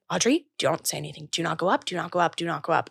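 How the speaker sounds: low, soft, repeated self-instruction; hushed self-warning. Retold or imitated. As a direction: talk yourself down under your breath. Retells stopping herself, self-talk.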